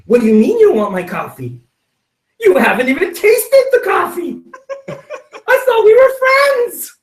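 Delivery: rising to a high, mock-wounded protest; offended friend. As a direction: play the hurt friend, hamming it up. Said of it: "would the appropriate reaction be".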